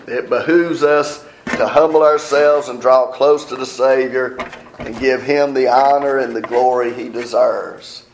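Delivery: normal talking voice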